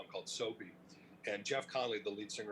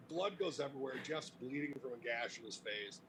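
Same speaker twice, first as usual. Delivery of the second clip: ordinary talk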